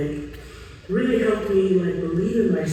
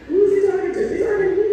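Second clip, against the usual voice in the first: much higher, louder, incredulous; stunned disbelief; 13 semitones higher, 4 dB louder, much faster than usual. Retold or imitated. Serena retelling her shock at coach Nick, high and indignant. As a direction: imitate her young self's shocked outrage. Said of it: "And I was like".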